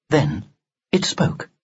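ordinary delivery, nothing marked

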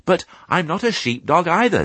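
high-pitched piglet voice; young piglet. Acted. Piglet answering the old sheep, high piglet voice. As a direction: speak up as an eager little pig.

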